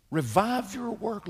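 high, slow cry of revive; impassioned cry. Acted. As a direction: lift revive up high and stretch it out. Preacher repeats prayer emphatically.

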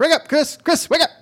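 high voice, calling to wake someone; high sleepy wake-up call. Retold or imitated. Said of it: "And you'd go".

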